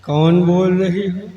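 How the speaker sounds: laughing. Acted. Laughing on phone call.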